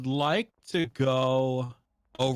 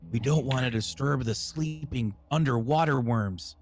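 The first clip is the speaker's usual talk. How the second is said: usual speaking voice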